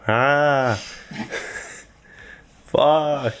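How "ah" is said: mock panic scream. Mock plane crash panic.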